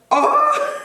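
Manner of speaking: high, loud, real yelp of shock; startled cry. Real, not acted. Nervous cutter startled by own snip, high startled cry. Startled mid-haircut after cutting.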